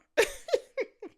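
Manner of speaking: shrill giggle; high laugh. Real, not acted. Laughing at Steph reveal.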